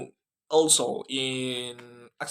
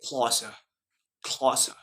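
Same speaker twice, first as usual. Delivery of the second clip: British "closer" with a silent R; British accent. Shown as a demonstration. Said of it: "but in British".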